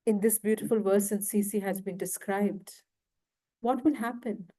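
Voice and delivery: normal talking voice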